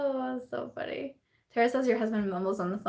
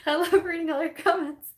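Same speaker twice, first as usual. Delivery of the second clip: high, squeaky with real laughter; breathless from giggling; tearful laughter; 6 semitones higher than usual. Real, not acted. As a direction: talk while cracking up. Laughing fit over viewers' comments.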